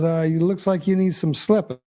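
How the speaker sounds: put-on mobster, menace dressed as friendly talk; mobster accent. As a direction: threaten him like a movie gangster. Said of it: "Mob shows back up and says".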